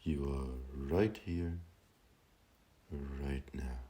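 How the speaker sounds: very slow, drawn-out meditation guidance; guided meditation. Acted. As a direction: guide them gently into stillness, stretching each phrase with long pauses. Leads a meditation.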